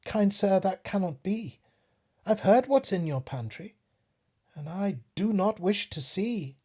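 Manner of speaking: politely but firmly turn him down; little fly. Acted. Fly refuses the spider.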